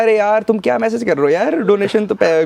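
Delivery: playful kidding. Retold or imitated. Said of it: "मैं मस्ती कर रहा".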